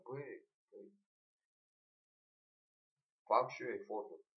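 ordinary talk, nothing marked

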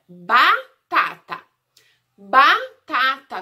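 "batata" split into loud, separate syllables. Shown as a demonstration. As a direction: sound out "batata" slowly, one syllable at a time. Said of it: "começa com qual sílaba?".

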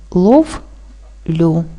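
"ловлю" split into "лов", long pause, "лю"; syllable by syllable. Shown as a demonstration. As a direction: say "лов", stop, then "лю". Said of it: "Правильно поділити на склади буде так". («ловлю» по складах: лов — лю)

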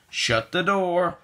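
ordinary delivery

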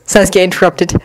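plain reading voice

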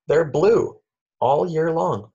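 excited reveal. Acted. Reader revealing the blue spruce to children, excited. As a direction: land the surprise with glee.

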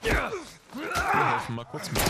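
loud, high burst of excitement; excited shout. Real, not acted. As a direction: shout it out as you charge in. Streamer jumps into game fight.

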